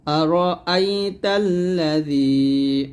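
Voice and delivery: slow recitation, drawing out the last syllable of তাল্লাযী; long held vowel. Shown as a demonstration. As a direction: stretch the "যী" of তাল্লাযী evenly. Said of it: "এক লিপ টান হবে".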